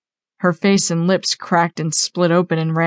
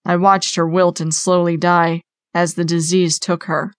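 usual speaking voice

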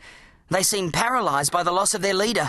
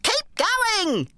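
muffled high urging shout. A narrator's reading. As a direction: urge him on from inside the bin. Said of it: "Gramps' muffled voice".

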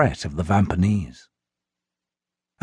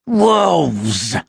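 higher, disgusted snarl; 5 semitones higher than usual. A narrator's reading. Vampanese snarls at the wolves in disgust. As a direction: spit the word out. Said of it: "the Vampanese snarled".

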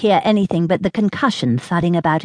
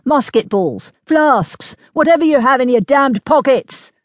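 urgent, rising to a higher pitch; snapped order; 6 semitones higher, 3 dB louder than usual. A narrator's reading. Major demands anything to load the cannon, snapping. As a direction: fire off demands like a harried officer. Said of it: "he snapped".

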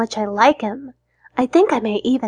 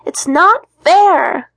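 slow, higher, louder sulky whine; pouting whine; 6 semitones higher, 5 dB louder, much slower than usual. Acted. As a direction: drag out a sulky protest. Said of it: "I say for the nineteenth time, pouting".

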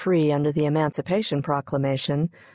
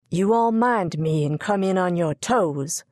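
plain reading voice

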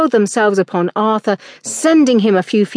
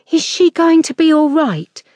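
put-on higher, louder boy's voice near tears; frightened boy; 8 semitones higher, 4 dB louder than usual. A narrator's reading. Boy asking the man about the beaten woman, frightened. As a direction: ask fearfully as a scared child. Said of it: "fright and tears in his voice".

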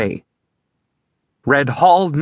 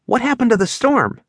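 bewildered question; 8 semitones higher than usual. Acted. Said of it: "looked up at the sky and asked".

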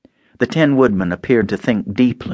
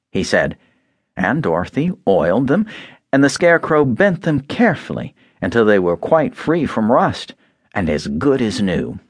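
ordinary delivery

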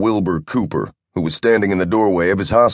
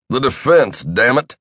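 a mild snap, a touch higher; snapped; 5 semitones higher than usual. Acted. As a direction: bite off the words in frustration. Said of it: "snapped Cooper".

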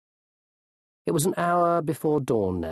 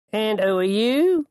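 higher, raised questioning voice; challenging ostler; 9 semitones higher, 4 dB louder than usual. A narrator's reading. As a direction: challenge a stranger in the corridor.

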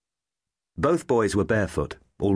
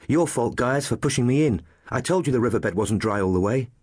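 put-on higher-pitched boy blaming his friend; a touch of boyish accusation, pitched up; lightly voiced boy; 5 semitones higher than usual. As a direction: play a young boy answering back.

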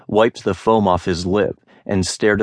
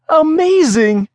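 much higher, louder thrilled cry; excited exclamation; 6 dB louder than usual. A narrator's reading. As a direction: burst out in delight. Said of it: "the merchants exclaimed excitedly".

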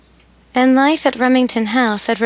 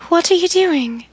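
startled; 8 semitones higher than usual. A narrator's reading. Emily startled, flung over lap.

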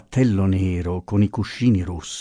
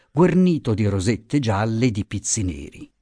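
usual speaking voice

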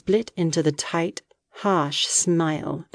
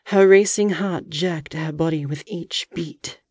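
plain reading voice

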